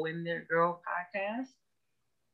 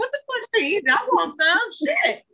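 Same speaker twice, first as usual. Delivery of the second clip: loud mock outburst; 6 dB louder than usual. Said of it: "I'm just like".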